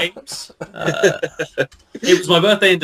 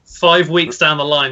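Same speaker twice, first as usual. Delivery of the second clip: normal talking voice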